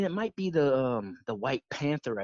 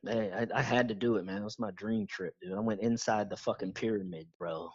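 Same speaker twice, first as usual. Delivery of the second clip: ordinary talk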